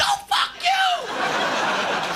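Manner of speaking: high, very slow shriek; drawn-out falsetto curse. Retold or imitated. Wife cursing husband as she storms out, in falsetto.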